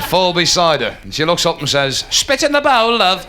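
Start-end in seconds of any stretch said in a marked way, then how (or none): 2.1-3.3 high-pitched matter-of-fact dentist; sudden high instruction; dentist breaking dream. Acted. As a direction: call it high to a waking patient. Dream woman turns into dentist.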